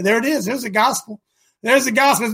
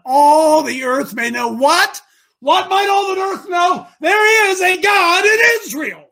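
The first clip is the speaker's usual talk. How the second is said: rising louder to a full shout; building to a shout; 7 semitones higher, 5 dB louder than usual. Real, not acted. Preacher proclaiming God in Israel to the congregation, building to a shout. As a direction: build the line to a triumphant peak.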